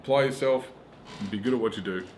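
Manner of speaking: normal talking voice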